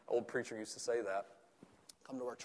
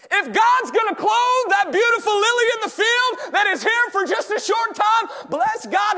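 high, loud shouted sermon; full-force preaching; 19 dB louder than usual. Acted. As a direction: belt it out to the back pew.

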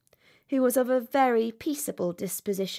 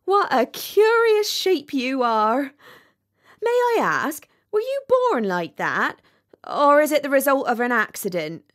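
louder, higher duck voice; inquisitive duck; 4 semitones higher, 7 dB louder than usual. A narrator's reading. Duck asks rocket about his curious shape, loud and inquisitive.

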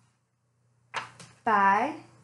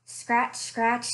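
softer, storytelling read-aloud voice; story narration; 4 semitones lower, 3 dB quieter than usual. A narrator's reading. Librarian reading a bear story to children, gentle storytelling. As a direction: draw the kids into a cozy mystery tale.

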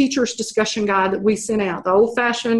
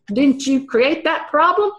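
imitating a smug heckler, pitched higher; smug challenge; 5 semitones higher than usual. Big guy in the back challenging the speaker, smugly. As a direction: pose it as a smug gotcha question. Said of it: "kinda smug".

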